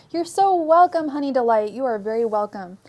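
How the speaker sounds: ordinary delivery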